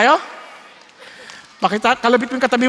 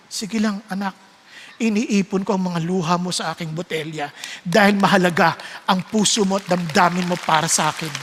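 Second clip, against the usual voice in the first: a touch lower and softer, gently comforting; tender comfort; 5 semitones lower, 4 dB quieter than usual. Pretend. God comforting his crying child, softly tender.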